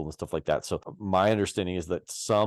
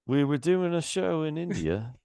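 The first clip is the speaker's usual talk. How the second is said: high, put-on storyteller character; Wayne's World impression; 9 semitones higher than usual. Podcaster quoting the guy in Wayne's World, high impression. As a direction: imitate the film's roadie character. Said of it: "line from Wayne's World where the guy's like".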